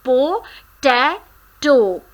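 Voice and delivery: Po-ta-to spoken slowly, syllables split. Shown as a demonstration. Teacher splitting potato into syllables slowly. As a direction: break Po-ta-to into its three parts slowly. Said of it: "made up of three syllables".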